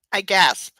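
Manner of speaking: ordinary talk, nothing marked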